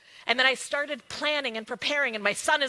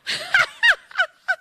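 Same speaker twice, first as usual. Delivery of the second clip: a high, loud burst of laughter; gleeful high laugh; delighted laugh; 9 dB louder than usual. Real, not acted. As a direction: break into a big squealing laugh. Laughs at her own boldness.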